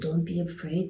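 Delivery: low, soft reassurance; God's voice. A narrator's reading. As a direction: gently soothe away the fear. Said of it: "the God's voice said".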